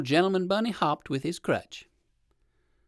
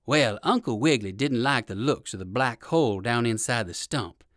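ordinary delivery

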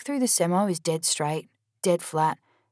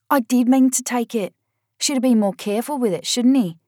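louder and higher, fast and cocky; defiant; 6 semitones higher, 6 dB louder than usual. Acted. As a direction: throw it back in her face. Charlie defiant in quarrel.

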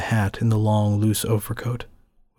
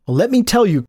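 loud, higher snap of defiance; defiant outburst; 11 semitones higher, 6 dB louder than usual. A narrator's reading. Scene: Dalla turns on the narrator, loud and defiant. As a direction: bite back at him.

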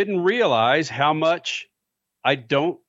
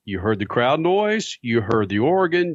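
normal talking voice

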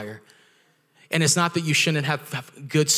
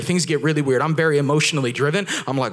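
normal talking voice

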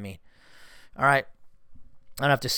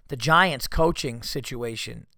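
ordinary talk, nothing marked

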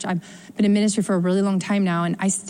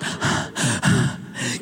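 shocked gasp; 9 semitones lower, 5 dB quieter than usual. Retold or imitated. Congregant shocked by advice.

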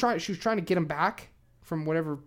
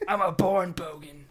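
mock Australian bogan. Plays a newborn Australian bogan.

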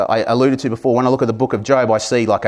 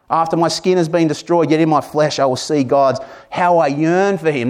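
lifted, higher reading of Job's words; scripture reading; 5 semitones higher than usual. Acted. Pastor reading Job's plea to the congregation, higher and earnest. Reads Job nineteen aloud.

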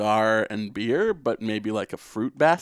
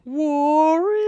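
high, drawn-out singsong call; mock film-villain chant, pitched way up; taunting movie call. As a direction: stretch it into an eerie singsong taunt. Mimics the film line after Warriors mentioned.

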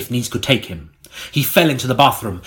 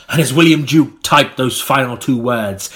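ordinary delivery

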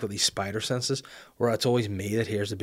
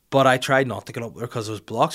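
ordinary talk, nothing marked

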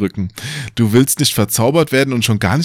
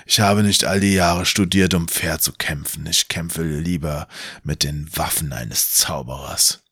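lightly voiced mocking wizard; 5 semitones lower, 4 dB quieter than usual. Wizard Kallmann speaks.

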